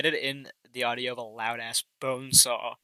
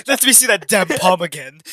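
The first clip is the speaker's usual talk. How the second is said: loud, higher, playful outburst; mock demand; 7 semitones higher, 10 dB louder than usual. Co-host playing psychic asking for palm, loud mock demand. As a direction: burst out in a hammed-up demand.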